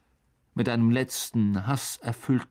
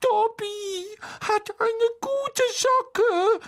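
squeaky, very high and slow; stretched-out elf disbelief; disbelieving house-elf; much slower than usual. A narrator's reading. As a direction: marvel in a tiny high creature voice. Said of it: "sagte Dobby ungläubig".

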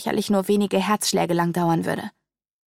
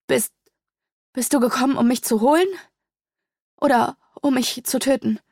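fearful stammer; 5 semitones higher than usual. A narrator's reading. Sedonia fears being killed.

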